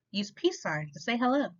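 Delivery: ordinary delivery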